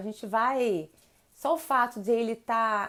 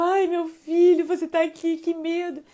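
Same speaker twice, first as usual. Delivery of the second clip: mock panicked mother, higher and louder; panicky parent; 6 semitones higher, 6 dB louder than usual. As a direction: ham up a frightened mom's relief. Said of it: "Sem aquele também chororô, tipo, aquele pânico".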